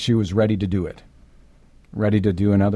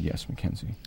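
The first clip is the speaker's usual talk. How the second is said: a softer, breathy whisper of yes; gentle answer; 4 semitones lower, 10 dB quieter than usual. Retold or imitated. Father telling his dying daughter yes, in a soft whisper. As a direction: break it to her as gently as possible.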